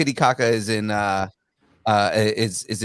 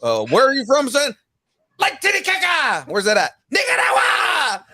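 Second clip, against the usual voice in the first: cartoon character voice; 10 semitones higher than usual. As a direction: shout it like a cartoon kid. Quoting a cartoon scene.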